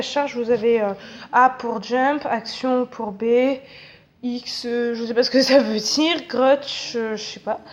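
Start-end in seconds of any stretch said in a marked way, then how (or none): none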